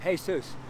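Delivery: "Jesus" said the Spanish way, "HAY-soos"; "Jesus" with an h sound and oo vowel, "hay-SOOS"; Spanish pronunciation. Retold or imitated. Preacher showing how the Spanish man says the name, "Jesus" as "hay-soos". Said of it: "he just looked up".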